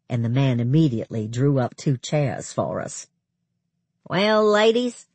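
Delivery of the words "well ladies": challenging. A narrator's reading. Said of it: "in his typically challenging way".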